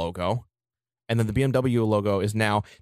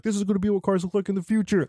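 hyped-up car exec; 9 semitones higher, faster than usual. Retold or imitated. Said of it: "they were like".

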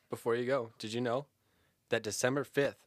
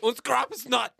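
put-on high, loud laughing German accent; mock German; 11 semitones higher, 7 dB louder than usual. Host slipping back into a hammy German accent. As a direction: throw in one more goofy German phrase. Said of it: "I had to do it".

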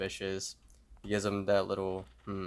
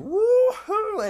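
very high, loud woohoo; long celebratory falsetto whoop; excited whoop; 9 dB louder than usual. Retold or imitated. Fake lottery winner running off, whooping excitedly. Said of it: "He's all excited".

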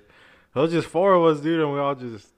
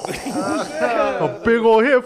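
big inhale; 7 semitones higher, 5 dB louder, much slower than usual. Retold or imitated. Imitating friend's big hit.

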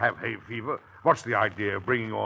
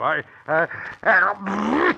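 about to sneeze; 10 semitones higher, 4 dB louder, much slower than usual. Acted. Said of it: "Bless you".

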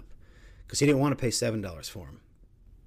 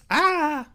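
shriek of disgust; 9 dB louder than usual. Retold or imitated. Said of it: "And she's like".

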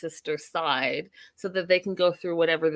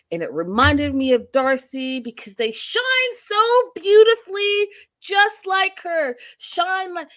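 building, much higher and louder toward beautifully; gushing mock romance; 10 semitones higher, 6 dB louder than usual. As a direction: swoon over the diamonds, rising high. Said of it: "isn't that a song".

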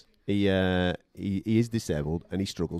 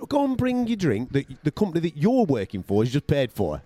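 higher, sarcastic take on the cheeky mate; mock indignation; 6 semitones higher than usual. Narrator sends up the freeloader's demand, high and mocking. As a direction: send up his nerve, pitched high. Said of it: "mocking the freeloader's demand".

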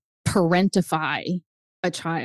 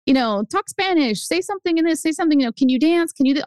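lightly mocking kid, pitched up; higher, pestering string of demands; older kids' demands; 8 semitones higher than usual. Older kids demanding tricks of a little show pony, pestering. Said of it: "the older kids would be like".

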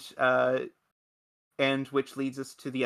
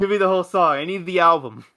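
louder laughing demand; laughing mock plea; 5 semitones higher, 7 dB louder than usual. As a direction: join the joke, laughing loudly. Echoing joke, laughing.

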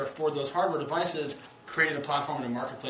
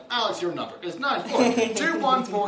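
lightly imitated robotic phone voice; automated voice; 7 semitones higher, 3 dB louder than usual. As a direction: recite it like a machine reading numbers. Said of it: "whenever I hear one of those automated voices".

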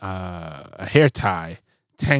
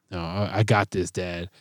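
very soft, lower reply; imitated quiet refusal; 5 semitones lower, 10 dB quieter than usual. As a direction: turn down help under your breath, as a child. Voicing daughter declining his help.

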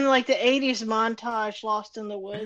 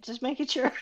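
usual speaking voice

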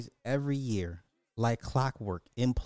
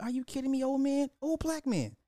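high-pitched disbelief, way up; incredulous; 12 semitones higher than usual. Retold or imitated. Vlogger mocking the crying fan, high incredulous squeal. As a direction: squeal it out in mock shock. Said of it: "I was like".

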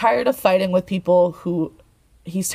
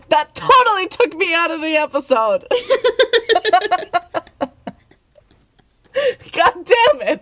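high laughing outburst; 10 semitones higher, 4 dB louder than usual. Real, not acted. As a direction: laugh through the frustration. Laughing frustration.

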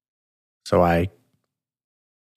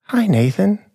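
put-on high, sweet little old lady's greeting; a sweet, high "Hi, Nathan" from a frail grandmother; grandmother's sweet voice; 11 semitones higher than usual. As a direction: pipe it up like a tiny grandmother. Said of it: "Grandmother's sweet voice".

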